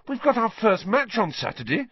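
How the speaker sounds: high-pitched outraged protest. A narrator's reading. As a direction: protest in disbelief, pitch shooting up.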